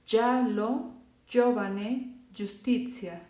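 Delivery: giallo, giovane, giustizia with a soft dj sound; Italian soft gi. Shown as a demonstration. Teacher models the Italian gi sound. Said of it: "se pronuncia".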